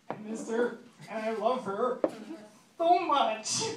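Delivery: high-pitched, put-on tearful whimper; mock crying. Heartbroken guy confessing to his friends, mock crying. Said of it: "it was pretty much my, my crying".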